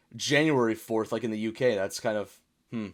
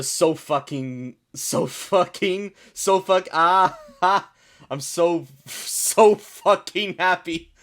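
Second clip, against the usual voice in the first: very slow, emphatic delivery, rising higher and louder; drawn-out reading; 5 semitones higher, 6 dB louder, much slower than usual. Acted. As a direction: stretch out each word, building as you go. Reading a pun aloud, drawn out.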